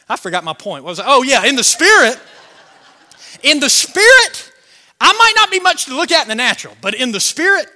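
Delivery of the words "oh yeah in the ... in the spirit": loud, raised pulpit declaration; rousing preacher's delivery; full-force preaching. Real, not acted. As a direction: seize the point and proclaim it.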